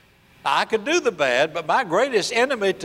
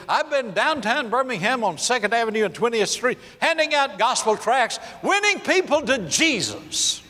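high, proud, excited retelling; mock the student's eager bragging; eager boasting; 7 semitones higher than usual. Preacher imitating a student proudly bragging about tracts, high and eager.

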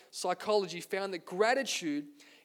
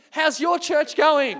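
slow and much louder, mock-cringing; "How's your church going?" thrown back, louder and embarrassed; awkward echo; 7 semitones higher, 12 dB louder, slower than usual. Young pastor asks the famous pastor the same question. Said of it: "And then I asked him".

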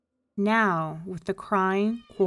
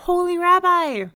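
high, loud happy call; joyful cry; 10 semitones higher, 11 dB louder than usual. A narrator's reading. Grateful man returning to the rabbi, joyful cry. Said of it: "he cried".

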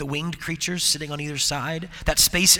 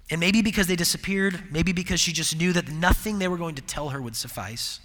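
ordinary talk

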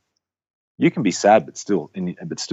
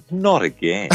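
higher-pitched mock-weary toddler; small child's protest, pitched up; Axel; 7 semitones higher, slower than usual. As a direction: mimic a little boy who has had enough. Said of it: "he looked at her and said".

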